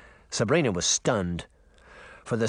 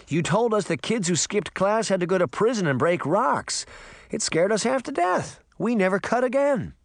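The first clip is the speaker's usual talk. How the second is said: high-pitched urgent reminiscing; insistent uncle; 8 semitones higher than usual. Acted. Uncle Jake urges the sheriff to remember him, insistent higher voice. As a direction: pile up memories to prove he knows you.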